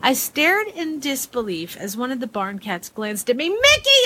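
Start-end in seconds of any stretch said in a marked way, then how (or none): 3.5-4.0 high, loud startled yell of the cat's name; furious yell at the cat; screaming a name. A narrator's reading. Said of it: "I screamed at him".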